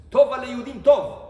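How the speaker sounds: loud and firm on טוב; emphatic divine command. Acted. God tells the scribes to write good, emphatic and loud.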